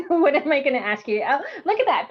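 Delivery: ordinary talk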